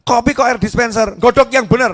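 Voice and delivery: hammed-up husband snapping at wife; mock angry husband. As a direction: bark the order to boil it properly. Husband scolding about dispenser water.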